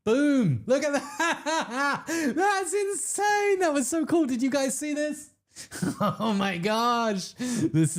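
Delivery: high, slow, laughing squeals; ecstatic squealing. Real, not acted. Streamer shrieks with delight as police lights flash. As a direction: lose it with joy.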